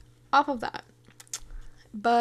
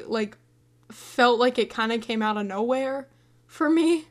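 normal talking voice

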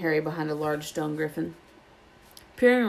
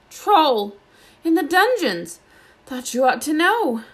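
much higher and louder, breathless terror; gasped panic; 10 semitones higher, 7 dB louder, slower than usual. Acted. Quirrell bursting in to warn Dumbledore of the troll, panicked. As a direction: blurt the warning in panic. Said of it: "and gasped".

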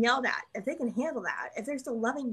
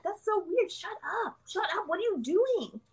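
scolding inner voice; 9 semitones higher than usual. Retold or imitated. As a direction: snap at yourself in a raised voice. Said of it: "you're like".

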